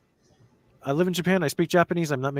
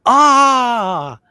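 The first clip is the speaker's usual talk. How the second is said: sudden realisation; 13 semitones higher, 12 dB louder than usual. Retold or imitated. Mock listener suddenly understands.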